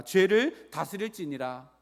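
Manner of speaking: loud, slow model phrase; call-and-repeat reading. Shown as a demonstration. Preacher has congregation repeat the verse, loud measured delivery. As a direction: give the line loudly and deliberately for repeating. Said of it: "따라하겠습니다".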